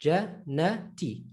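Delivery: Jannati in three separate syllables, short N; single noon, syllabified. Shown as a demonstration. Teacher asking students to note the noon, separated syllables. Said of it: "Take note of the noon".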